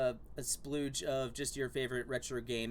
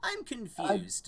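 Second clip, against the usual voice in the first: slow, higher goofy voice; dopey voice; 7 semitones higher, much slower than usual. Pretend. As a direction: play it dim and lost. Slow high put-on voice.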